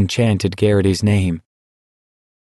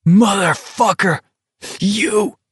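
much higher, raging outburst; enraged yell; 11 semitones higher, slower than usual. Acted. Garrity exploding at Stebbins in rage, much higher.

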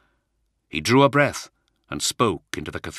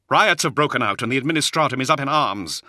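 loud, high-pitched, angry delivery; angry report; 8 semitones higher, 6 dB louder than usual. A narrator's reading. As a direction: throw the bad news at them angrily. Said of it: "He spat angrily".

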